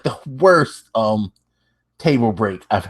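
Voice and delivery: usual speaking voice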